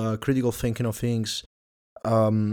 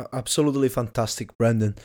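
normal talking voice

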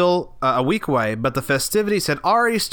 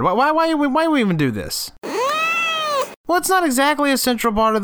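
exasperated whine; 12 semitones higher than usual. Acted. Whining at interviewer about doomed interview.

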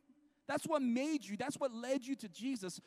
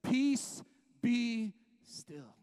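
commanding rebuke; 4 dB louder, much slower than usual. Acted. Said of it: "rebuked the winds and said".

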